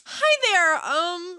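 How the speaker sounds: exaggerated squeaky-high greeting; hammed-up mimicry of a chirpy customer; customer service voice. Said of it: "It's my customer service voice".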